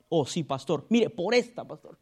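a lying member swearing to the pastor, lightly voiced; low, soft mock oath; mock congregant. Pastor imitating a liar swearing to him, low and soft.

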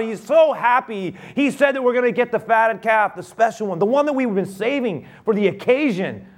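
high, loud, breathless good news; overjoyed servant spilling the news; excited servant. Acted. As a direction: gush the news of the return. Servant tells joyful news.

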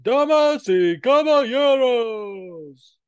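high, loud, slow Spanish ring call; Spanish announcer. Retold or imitated. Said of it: "he'd be like".

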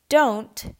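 slow, loud sharp warning; sharply. Acted. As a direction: stop his hand with your voice. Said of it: "Phillips said sharply".

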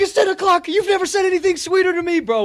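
very high, loud delight; overjoyed. Retold or imitated. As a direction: gush like it's the best news ever. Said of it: "I was like".

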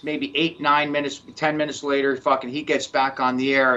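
ordinary talk

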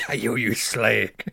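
put-on slow, playful Cockney accent; drawn-out Cockney accent insult. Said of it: "Wow, it's like I'm there".

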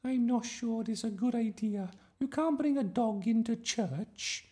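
very high-pitched, uneasy objection; flustered teacher. A narrator's reading. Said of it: "Miss Darling looked flustered".

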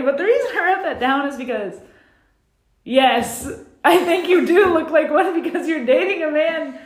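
high, loud talk through laughter; laughing. Real, not acted. Telling the cohost her opinion, laughing. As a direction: giggle through the verdict.

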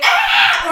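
high, loud reenacted scream; sudden shriek in acted panic. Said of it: "Y yo en pánico". (grito de pánico actuado)

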